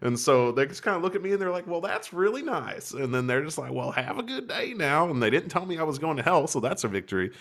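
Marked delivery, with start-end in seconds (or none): 1.7-5.1 slow, high, forced politeness; lightly imitating a strained-nice stranger; mock polite.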